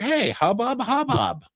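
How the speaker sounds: gossiping townsfolk voice; mock crowd chatter. Crowd voiced distracting guards.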